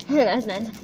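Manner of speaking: chewing while talking; sticky candy in mouth; mouth full of candy. Real, not acted. Seen 2: right girl's jaw moves, mouth working on candy as she speaks.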